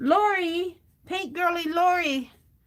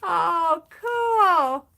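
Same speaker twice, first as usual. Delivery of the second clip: louder happy exclamation; delighted; 4 semitones higher, 5 dB louder than usual. Real, not acted. Host reacting to a viewer's London trip, delighted. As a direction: burst out with delight.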